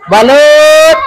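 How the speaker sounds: hawker's shout across the street; vendor's call. Real, not acted. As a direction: call out to buyers. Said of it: "sa pagsigaw ko".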